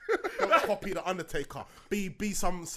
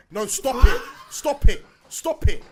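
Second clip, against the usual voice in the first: loud, climbing higher; worked-up outburst; 13 semitones higher, 15 dB louder, slower than usual. Real, not acted. Podcaster scolding an imposter, worked-up high shouting. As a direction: lose control as it repeats. Mock-furious rant at the imposter.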